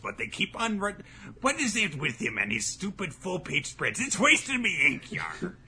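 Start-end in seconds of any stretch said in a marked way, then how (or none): 1.4-5.6 high hammed-up pirate grumble. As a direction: grouse like a stingy pirate captain. Continuing pirate editor bit.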